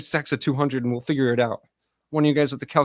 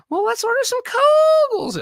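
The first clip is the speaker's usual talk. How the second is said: whiny wife voice; 7 dB louder than usual. Retold or imitated. Retelling his wife asking for Kogels in a high whine. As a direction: squeal it like a spoiled wife. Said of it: "that's all I heard in Texas".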